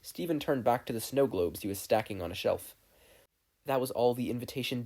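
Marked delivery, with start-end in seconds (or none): none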